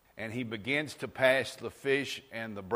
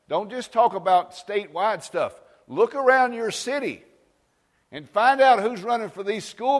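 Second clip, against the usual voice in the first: loud, higher exhortation to the church; fervent raised preaching; preaching at full force; 6 semitones higher, 7 dB louder than usual. Real, not acted. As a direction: drive the call home to the congregation.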